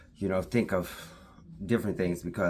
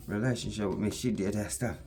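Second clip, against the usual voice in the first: ordinary talk, nothing marked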